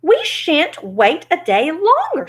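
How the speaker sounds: slow, high-pitched indignant refusal. Acted. Scarecrow refuses to wait for Oz, indignant and slow. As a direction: draw it out high and firm.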